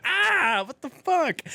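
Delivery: very high, loud ouch; high pained yelp. Retold or imitated. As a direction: yelp in pain at the rib kick. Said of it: "I was like".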